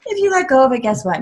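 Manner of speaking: ordinary talk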